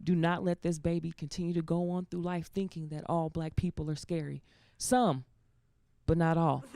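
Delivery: low, soft elder's admonition; imitated grandmother. Grandmother to granddaughter on a teachable moment, low imitated lecture. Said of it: "I could hear my grandmother say".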